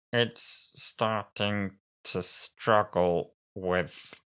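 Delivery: very slow, struggling robot; Cyberman running out of battery. Acted. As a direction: labour through each word, draining away. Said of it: "is running out of batteries".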